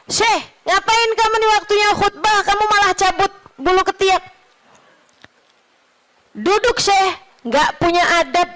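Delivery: high-pitched mock reprimand; retold scolding. Congregant orders the sheikh to sit down, high indignant scolding.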